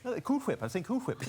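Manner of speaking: "Cool Whip" with a breathy hw; emphasis on the H. Acted. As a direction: lean on the H in "Whip". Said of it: "putting a lot of emphasis on the H".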